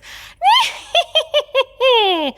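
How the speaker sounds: shrieking high witch cackle, far forward in the face. Shown as a demonstration. A singing teacher demonstrates her witch cackle. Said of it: "your best witch cackle".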